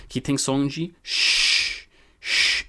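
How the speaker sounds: slow, stretched sh sound; English sh. Shown as a demonstration. Teacher demonstrating the sh sound. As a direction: draw out the "sh" in "que tem som de sh, sh". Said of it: "S H".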